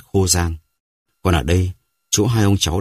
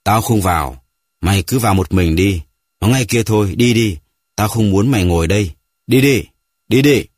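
put-on old man shouting; 3 semitones higher, 4 dB louder than usual. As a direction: drive the boy away, getting louder. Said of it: "Ông lão lúc này đã quát lên, giọng khản đặc".

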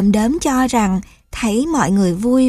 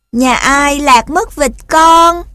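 high, loud, drawn-out cat; meowing cat call; 5 semitones higher, 7 dB louder, slower than usual. A narrator's reading. Cat joins in calling for the family, meowing call. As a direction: call out as a lightly voiced cat. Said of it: "cũng meo meo kêu lên theo".

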